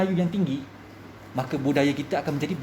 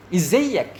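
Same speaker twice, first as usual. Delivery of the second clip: Egyptian dialect demo, a bit louder; rough Egyptian Arabic; 4 dB louder than usual. Shown as a demonstration. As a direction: demonstrate coarse Egyptian Arabic.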